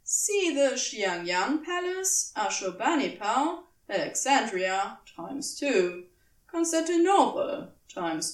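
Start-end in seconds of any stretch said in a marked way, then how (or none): none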